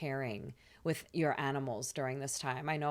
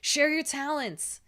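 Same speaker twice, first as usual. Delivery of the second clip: high, loud, rallying shout; mock scream; 10 semitones higher, 7 dB louder, slower than usual. Jupiter in Aries urging hesitant Virgo on, mock scream. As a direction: holler it like an eager hype call. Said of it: "Jupiter in Aries screams".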